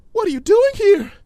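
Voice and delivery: high, loud frightened demand; alarmed outburst. Acted. Fluttershy confronting Discord after a fright, loud alarmed cry. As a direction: cry out still shaken.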